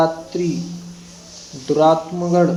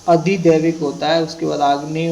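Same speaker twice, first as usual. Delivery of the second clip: normal talking voice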